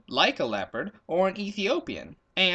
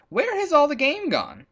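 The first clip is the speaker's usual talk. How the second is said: high, louder leopard voice asking; a lightly voiced leopard, pitched up and pressing; leopard's question; 11 semitones higher, 4 dB louder than usual. Hungry leopard asks the baboon, high and louder.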